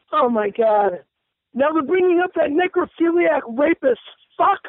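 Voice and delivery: ordinary talk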